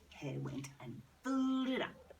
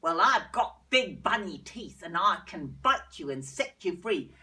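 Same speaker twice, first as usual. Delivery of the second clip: hare voice; 3 semitones lower, 9 dB louder than usual. Acted. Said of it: "looked at it and said".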